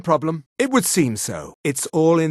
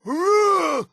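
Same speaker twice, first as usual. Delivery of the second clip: high, drawn-out combat cry; effort cry; 12 semitones higher than usual. Acted. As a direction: strain out a high attack sound.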